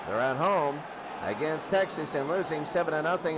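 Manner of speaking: ordinary talk, nothing marked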